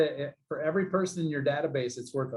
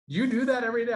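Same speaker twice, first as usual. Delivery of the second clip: high, loud amazed question; a friend who can't believe it; amazed disbelief; 10 semitones higher, 4 dB louder, faster than usual. Retold or imitated. Tony amazed, laughing at him.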